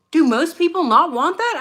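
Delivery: can't believe anyone wouldn't want it; incredulous. Real, not acted. Incredulous rhetorical question to viewers.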